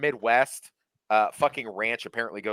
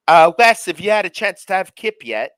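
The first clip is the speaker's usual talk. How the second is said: mock British coworker; 9 semitones higher, 11 dB louder than usual. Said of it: "they'd be like".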